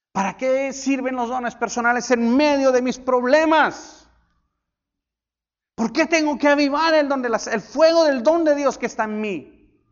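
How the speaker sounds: loud, high preaching cry; full-force sermon shout; fiery preaching. Acted. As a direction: preach it out with fire.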